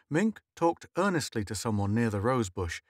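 ordinary delivery

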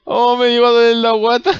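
ordinary talk, nothing marked

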